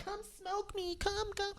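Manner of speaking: high, soft luring call; weed itself calling to the smoker, high and soft. Pretend. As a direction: coax them like a temptress plant. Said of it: "The weed is not like".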